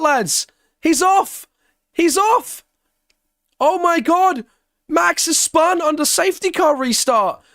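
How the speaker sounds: high, loud, astonished yelling at the screen; excited shout. Real, not acted. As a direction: shout it like you can't believe the spin. Live race, Max spins on restart.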